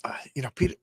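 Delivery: soft unvoiced aside to the character; whispered aside. Real, not acted. Host whispering a reproach to Peter, quiet.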